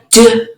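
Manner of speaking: "d" as a short "duh"; just the stop sound "d"; isolated phoneme. Shown as a demonstration. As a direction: sound out "d", not "dee". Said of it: "substituting phonemes".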